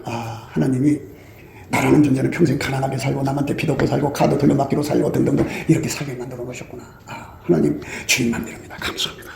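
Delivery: quiet grateful murmur to God; humble thankful prayer. Retold or imitated. (낮고 조용하게 속삭이듯 드리는 감사 기도)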